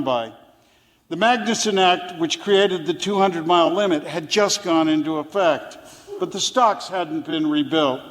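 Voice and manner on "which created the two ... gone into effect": usual speaking voice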